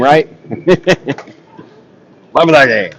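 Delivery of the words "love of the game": slips into a pirate voice. Real, not acted. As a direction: growl it like a pirate. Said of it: "I sounded like a pirate when I said that".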